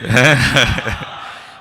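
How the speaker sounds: big, loud burst of laughter; real delighted laugh. Real, not acted. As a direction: laugh heartily at the punchline. Laughs at the story's punchline.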